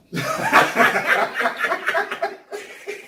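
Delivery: high-pitched burst of laughter; squealing laugh. Real, not acted. Laughs after showing the gap.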